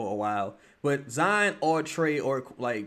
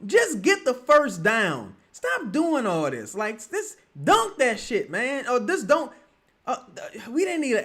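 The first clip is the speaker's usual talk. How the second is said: heated rant; 9 semitones higher, 3 dB louder than usual. Real, not acted. Ranting at missed fast break.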